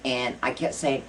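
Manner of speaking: ordinary delivery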